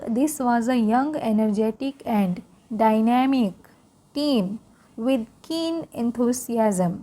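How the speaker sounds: slow, careful read-aloud for learners; English read-aloud for Hindi-speaking students, slow. Shown as a demonstration. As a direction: read it slowly so learners can follow along.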